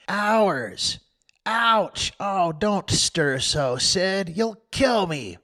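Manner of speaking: high, whimpering ouch; hammed-up pain. Tom faking agony to Sid, whimpering. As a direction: overplay every twinge.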